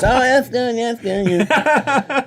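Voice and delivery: high mock Leno voice; amused Jay approving; imitating Jay Leno; Jay Leno impression. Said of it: "He goes".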